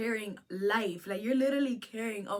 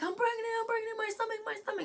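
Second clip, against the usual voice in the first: much higher mimicking voice; mock pleading; 12 semitones higher, much faster than usual. Storyteller mimicking the pregnant woman mid-fight, mock frantic plea. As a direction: imitate her panicked plea in high pitch.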